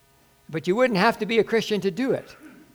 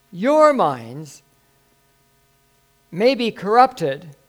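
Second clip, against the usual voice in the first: slow, raised preaching delivery; emphatic scripture reading; 3 semitones higher, 6 dB louder, slower than usual. Acted. Pastor reads Paul's warning to the congregation, slow and emphatic. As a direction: draw out the key words with swelling weight.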